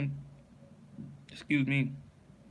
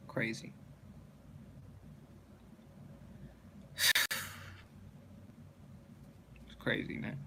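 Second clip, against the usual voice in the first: breathy whisper to himself, much softer; whispered aside; 4 semitones lower, 13 dB quieter than usual. Real, not acted. Reader breaks off the word list to whisper an aside. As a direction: mutter it under your breath, amused.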